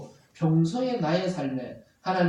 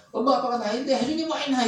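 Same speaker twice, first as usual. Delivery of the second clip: higher-pitched mock defiance; rebellious child; 6 semitones higher than usual. Preacher imitates child defying parents, rebellious and higher-pitched. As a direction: imitate a child talking back. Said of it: "반항할 때 보면".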